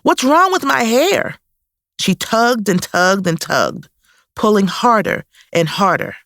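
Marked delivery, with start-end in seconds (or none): 0.0-1.4 put-on high, alarmed little girl; a small child suddenly frightened about her hair; upset child. A narrator's reading. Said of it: "she cried out".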